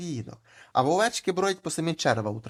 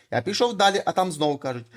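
usual speaking voice

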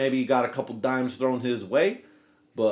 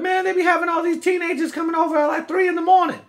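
much higher, fast, squeaky put-on neighbor voice; mocking the neighbor; 8 dB louder than usual. Teller mimics the neighbor complaining about late teenage visitors, in a much higher, fast, mocking voice. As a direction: ham up a nosy neighbor's tattling in a high pitched voice. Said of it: "the neighbor was like".

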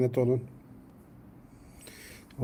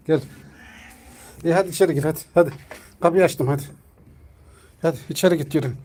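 urging the cat; 3 semitones higher, 6 dB louder, much faster than usual. Real, not acted. Urging cat through open door.

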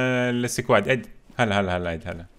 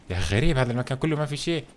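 ordinary talk

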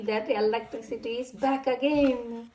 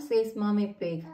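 ordinary delivery, nothing marked

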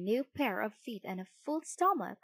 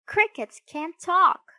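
high, loud, slow protest; scoffing retort; 9 semitones higher, 10 dB louder than usual. A narrator's reading. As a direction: puppet boy contradicting loudly. Pinocchio retorts to the cricket.

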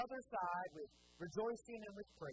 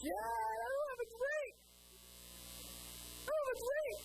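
put-on whiny kid; 14 semitones higher than usual. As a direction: whine like a toddler who wants a drink. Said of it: "And they go".

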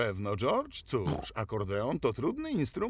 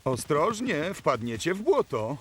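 loud, higher-pitched caution; raised warning call; urgent warning; 6 semitones higher, 6 dB louder than usual. Acted. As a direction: call out to warn the children. Warning children near mud.

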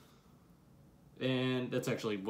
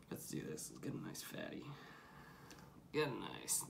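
whispering; 3 semitones lower, 14 dB quieter than usual. Real, not acted. Pinching dip from the can.

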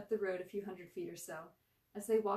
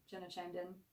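ordinary delivery

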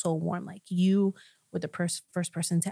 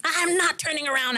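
high, stubborn refusal; defiant yell; 9 semitones higher than usual. Retold or imitated. Refusing while turning the car, high defiant yell. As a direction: fling the refusal out. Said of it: "I'm screaming".

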